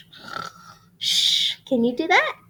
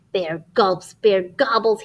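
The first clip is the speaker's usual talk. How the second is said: plain reading voice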